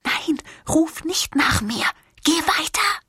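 high, slow, urgent whisper; hiding woman begging her friend in a whisper not to call; desperate whisper. Acted. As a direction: hiss it so nobody hears, pleading. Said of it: "flüsterte Melisande verzweifelt".

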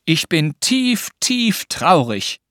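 put-on slow, heavy-hearted boy's lament; grumbled mock sadness. Anton joins the game, slowly grumbling his mock sorrow. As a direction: drag out each word in playful gloom. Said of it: "brummte er".

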